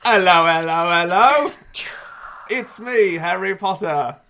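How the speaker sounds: high, loud bad British accent; Harry Potter British accent. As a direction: put on a hammy British accent. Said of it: "That's my British accent".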